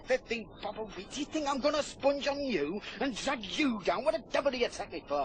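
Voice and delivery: rising, fast angry outburst. Acted. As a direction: snap back, getting angrier. Heated quarrel over money.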